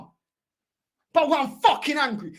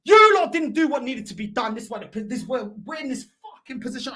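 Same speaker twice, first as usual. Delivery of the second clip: normal talking voice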